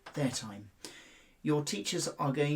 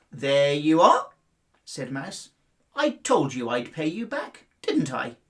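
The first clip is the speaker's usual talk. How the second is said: proud mouse; 8 dB louder than usual. Acted. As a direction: tell him so with a grin.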